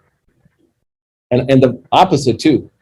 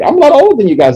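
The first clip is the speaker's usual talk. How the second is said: mock complaint; 6 dB louder, faster than usual. Real, not acted. Teacher joking about his age.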